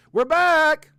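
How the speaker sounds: high, loud whoop of return; triumphant shout. Real, not acted. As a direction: belt it out, thrilled to be back. Triumphant shout after fixing mic.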